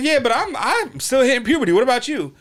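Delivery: high, loud mock-indignant comeback; defiant schoolkid. Student talking back to his teacher, high and indignant. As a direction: argue back in a high-pitched kid's voice.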